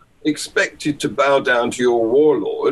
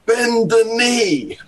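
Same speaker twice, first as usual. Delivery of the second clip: stern northern English "bend the knee"; a high, slow Scouse command; Liverpool accent; 8 semitones higher, slower than usual. Retold or imitated. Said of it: "did he do it in an English accent?".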